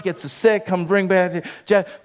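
ordinary delivery

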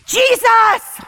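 high, loud cry of the name; yelled. Retold or imitated. Crying out at her brother in her room, loud and high. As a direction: shout it out for help. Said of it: "I just yelled".

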